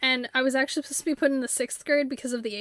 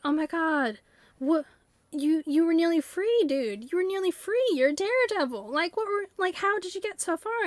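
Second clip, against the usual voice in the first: higher, playful astonishment; amazed disbelief; 4 semitones higher than usual. Pretend. Owner marveling at the runaway item, higher and amazed. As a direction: gush at the daredevil like you can't believe it.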